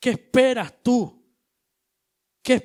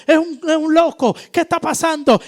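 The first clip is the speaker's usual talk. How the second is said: panicked disciples; 6 semitones higher, 8 dB louder than usual. Retold or imitated.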